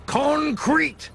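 high proud one-word answer; proud high boast. A narrator's reading. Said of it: "rattled Patrick".

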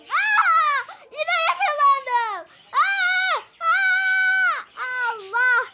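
loud high screams with a laugh; panicked screaming. Real, not acted. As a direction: shriek at being caught again. Caught by police again.

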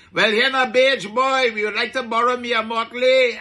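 put-on Caribbean accent. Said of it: "that little accent thing that I put on there".

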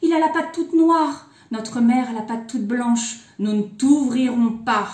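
gasp, then loud high protest; outcry. A narrator's reading. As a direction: exclaim loudly and refuse. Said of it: "et les biquets s'écrièrent".

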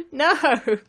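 loud high laugh into a cry of No; laughing protest. Real, not acted. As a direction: laugh in disbelief at the dead end. Dead end put by Mumbo.

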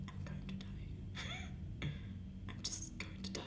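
slow whispered dread; scared whisper. Real, not acted. Player alone in the dark, certain he will die, slow frightened whisper. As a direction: whisper it like death is near.

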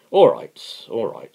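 loud, impatient brush-off. Acted. Crass snapping impatiently at Owen's lecture. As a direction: cut him off, fed up and loud. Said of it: "said Crass impatiently".

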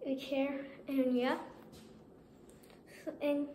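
muffled behind cloth mask; speaking inside costume mask; through a face mask. Real, not acted. Seen 0: Speaker wears a full fabric mask covering the mouth throughout.

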